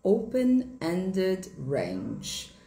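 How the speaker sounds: Open-ended range drawn out very slowly, American accent; teacher repeats the American term very slowly; slow American demo. Shown as a demonstration. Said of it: "no inglês americano".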